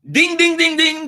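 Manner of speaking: high, loud game-show ding; bell ding. Acted. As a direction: ring it like a winner bell.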